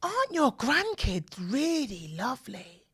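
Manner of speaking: slow, lightly voiced postman; postman's voice. Postman bending down to the kids, slow and lightly voiced. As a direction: coo it slowly like a friendly stranger. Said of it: "Postman bent down and go".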